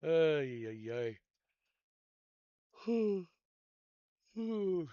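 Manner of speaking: a real high yawn sliding into a long ooh. Real, not acted. Yawning while showing the fields to everybody. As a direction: yawn out loud mid-sentence. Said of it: "Sorry, I'm yawning".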